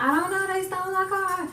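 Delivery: high, strained crying voice. Retold or imitated. Telling her mom the car is gone, crying. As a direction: choke it out through tears. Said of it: "I'm, like, crying and stuff".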